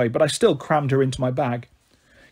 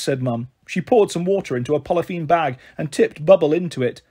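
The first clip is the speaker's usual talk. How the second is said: usual speaking voice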